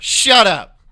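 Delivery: very loud, high outburst; sudden fed-up yell; exasperated shout. Real, not acted. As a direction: yell it to shut them down. Ranting about slang in selfies.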